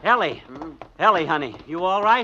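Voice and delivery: high, loud, worried calling; anxious call. Acted. Husband calling to his fallen wife, anxious. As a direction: call out to her.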